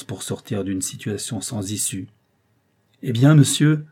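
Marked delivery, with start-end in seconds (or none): 3.0-3.8 higher, loud and confrontational; hostile challenge. A narrator's reading. Cornered painter turns on his rival, hostile challenge. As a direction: square up to him angrily.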